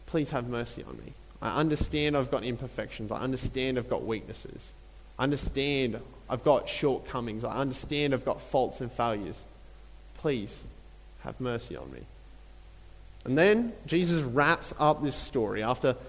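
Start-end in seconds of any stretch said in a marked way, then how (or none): 0.1-12.0 softer, pleading, dropping away to almost nothing; pleading confession. Retold or imitated. Tax collector begging God for mercy, soft and pleading. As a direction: confess each fault softly, begging for mercy.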